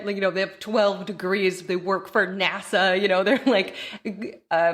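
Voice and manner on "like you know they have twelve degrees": usual speaking voice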